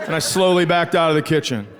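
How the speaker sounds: usual speaking voice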